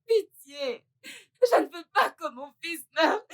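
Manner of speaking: crying out the plea, breaking with tears; tearful begging. Acted. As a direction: beg desperately, sobbing. Mother begging doctor for son. (la mère implore le docteur en sanglotant)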